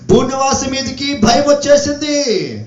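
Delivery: forceful proclamation. Real, not acted. Preacher warning all earth's inhabitants of fear, forceful proclamation. Climactic warning, then repeated subdued.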